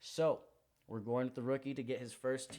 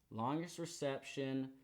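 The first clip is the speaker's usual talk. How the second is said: ordinary delivery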